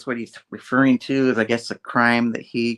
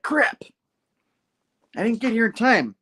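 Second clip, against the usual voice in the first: higher, frustrated "Crap"; frustrated hero; 11 semitones higher than usual. Retold or imitated. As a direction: blurt out your disappointment. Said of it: "Hunter's like".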